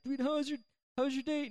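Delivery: high, keen imitation of a curious friend; eager questioning. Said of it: "was like".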